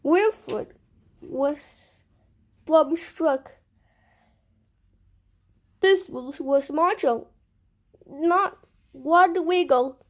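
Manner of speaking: usual speaking voice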